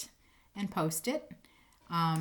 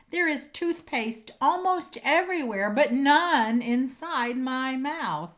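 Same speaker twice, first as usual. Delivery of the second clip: playful poem reading; 3 semitones higher, 4 dB louder, slower than usual. Acted. Teacher reading a silly toothpaste poem to kids, building to the punchline. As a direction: build the fun to the last line.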